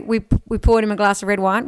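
usual speaking voice

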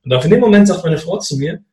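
ordinary delivery

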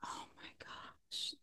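slow breathy whisper of disbelief; hushed, drawn-out groan of frustration; exasperated whisper. Real, not acted. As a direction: whisper it, worn out and annoyed. Frustrated reaction to confusing casting.

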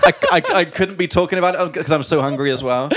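giggling through the words; laughing. Real, not acted. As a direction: laugh while you talk. Laughing while confessing pizza order.

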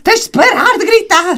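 high, drawn-out yell imitating a scolding adult; hammed-up telling-off at full pitch; mock shouting. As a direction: shout the ban at the children, stretched out. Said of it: "nós estamos a gritar".

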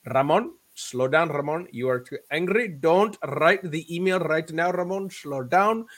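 put-on slow, deliberate Indian accent, lightly done; fond homage in a loose Indian accent; imitating Indian accent. Indian mentor calming angry young Ramon, slow Indian accent. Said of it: "That's a poor accent that he had".

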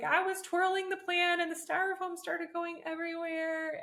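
high, mock tearful child; imitating crying daughter. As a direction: play the upset little girl explaining. Crying daughter voiced in story.

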